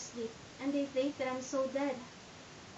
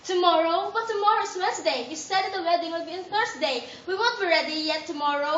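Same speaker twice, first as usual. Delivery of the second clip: loud protest; 7 dB louder than usual. Acted. Juliet loudly protesting to her mother about the wedding date. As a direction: push back loudly, you can't be ready.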